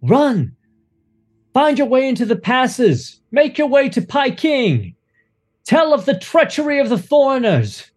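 high, urgent shouted warning; acolytes screaming. Acted. Acolytes screaming at the children to run, frantic. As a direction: yell at the children to flee. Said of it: "screaming to the children".

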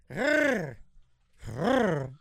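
rolling r like a purring cat; rolled r trill. Shown as a demonstration. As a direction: trill the r like a kitty. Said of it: "Like a kitty cat".

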